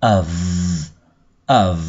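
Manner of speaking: of ending in a long buzzed v. Shown as a demonstration. Teacher models for students, of with voiced v ending. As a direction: hold the final v of of. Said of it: "mà đọc là âm V nhé".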